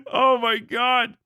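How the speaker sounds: slow, stunned oh my God; amazed. Real, not acted. Reacting to the view count with a slow, stunned exclamation. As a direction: let it sink in, awed.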